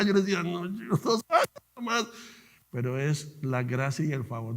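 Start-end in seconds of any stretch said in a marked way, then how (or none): 0.4-2.1 high, stretched-out protest; insistent answer. Retold or imitated. Answering disbelieving colleagues about his years, high insistent reply. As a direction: insist on it in a raised voice.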